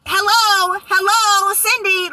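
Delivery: high, loud hello hello; loud high calling to get attention. Retold or imitated. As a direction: call her name like she's not there. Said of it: "my body was over here saying".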